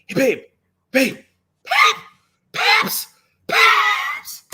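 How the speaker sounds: calling out in a hushed shout, rising high; strained half-whisper call across the plane; whisper yelling. Retold or imitated. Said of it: "whisper yelling".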